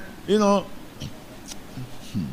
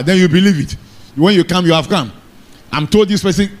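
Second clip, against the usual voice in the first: usual speaking voice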